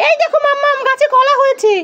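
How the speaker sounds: put-on high child-character voice; child character, eager. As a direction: show mother a find as a little one. Child character shows mother bananas. (বাচ্চার সরু গলায় মাকে দেখানো)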